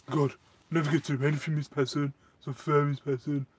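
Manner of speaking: put-on quick, whiny sulky teen voice; Kevin off Harry Enfield. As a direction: whinge fast like a stroppy teenager. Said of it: "she's literally like Kevin off Harry Enfield".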